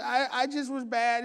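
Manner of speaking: put-on high, slow kid's confession; imitated child, high and halting; sheepish child. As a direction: own up like a small girl. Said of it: "She goes".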